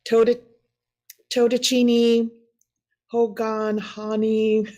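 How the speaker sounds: halting, sounded-out Totachini, Hogan, Hani; unsure reading of unfamiliar Navajo names; butchered clan names. Real, not acted. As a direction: stumble on Totachini, restart, then pause between names. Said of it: "I'm so sorry for butchering that".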